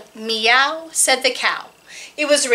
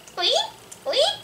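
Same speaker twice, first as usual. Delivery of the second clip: very high, soft squeaky peeps; tiny squeak; 13 semitones higher, 4 dB quieter than usual. Acted. Rooster trying to crow but only squeaking, tiny and high. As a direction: squeak it like a rooster who lost his crow. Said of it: "out came the tiniest squeak".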